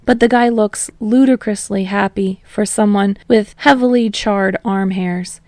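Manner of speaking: usual speaking voice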